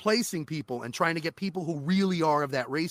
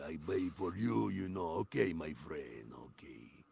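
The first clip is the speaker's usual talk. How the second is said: a lightly voiced guy, low and soft; accented game character; 12 semitones lower, 11 dB quieter than usual. Shown as a demonstration. Voice actor showing one of his game roles, low soft accented guy. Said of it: "a guy who's talking like this".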